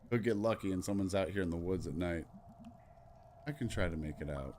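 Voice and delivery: normal talking voice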